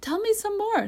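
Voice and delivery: high, eager request; excited. A narrator's reading. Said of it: "all excited".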